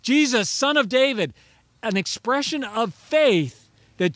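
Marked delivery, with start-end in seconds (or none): 0.0-1.3 a loud, higher desperate plea; pleading cry for help. Acted. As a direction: call out to Jesus like a blind beggar by the road. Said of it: "And he cries out".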